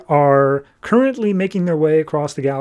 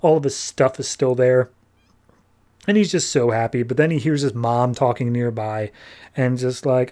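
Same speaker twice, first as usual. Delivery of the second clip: ordinary talk, nothing marked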